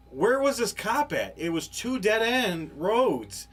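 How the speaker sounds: high, loud, slow disbelief; can't believe where this cop came from; incredulous. Retold or imitated. Shocked a cop appeared.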